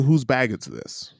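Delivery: timid polite asking; soft, slow mock question. Friend mocking his polite question, soft and slow. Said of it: "you were the aggressor by asking".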